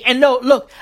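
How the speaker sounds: ordinary talk, nothing marked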